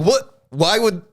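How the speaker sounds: high, loud imitated disbelief; hammed-up, high-pitched confusion; mock bafflement. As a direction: act out an incredulous coworker. Replaying coworker's baffled reply.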